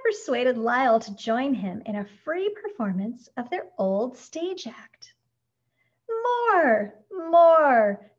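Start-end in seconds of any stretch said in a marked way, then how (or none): 6.1-8.1 high, loud drawn-out cheer; delighted calling out. A narrator's reading. Surprised shoppers to the performers, delighted calling out. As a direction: shout it like a thrilled audience. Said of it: "surprised but delighted shoppers called out".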